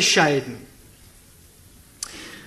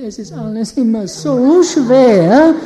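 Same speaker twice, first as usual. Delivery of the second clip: whiny voice; 10 dB louder than usual. Retold or imitated. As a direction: imitate a whiny complainer. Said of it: "die haben so eine weinerliche Stimme".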